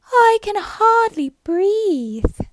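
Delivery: very high, loud, slow little Dormouse voice; squeaky Dormouse. Acted. The Dormouse complains to Alice, very high and slow. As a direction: squeak it out slowly like a squashed dormouse.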